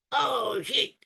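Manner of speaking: high, mock-panicked cry; Gomez. Soldier panicking under fire, high mock-panicked voice. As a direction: blurt it out in comic panic. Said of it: "but Gomez actually panics".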